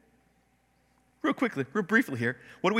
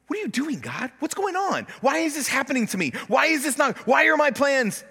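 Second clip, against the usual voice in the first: frustrated questioning; 8 semitones higher than usual. Retold or imitated. Acted complaint to God.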